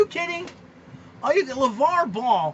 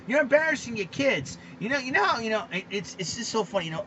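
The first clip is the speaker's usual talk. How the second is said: usual speaking voice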